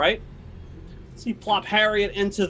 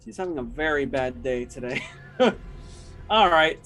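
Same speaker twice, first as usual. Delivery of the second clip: ordinary delivery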